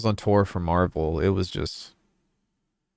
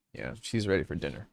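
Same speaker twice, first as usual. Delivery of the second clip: ordinary delivery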